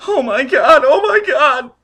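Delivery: woman crying out at a death; horrified crying. Acted. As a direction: sob it out in horror.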